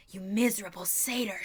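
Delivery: loud villainous snarl; angry snarl. A narrator's reading. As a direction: snarl the insult with force. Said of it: "she snarled".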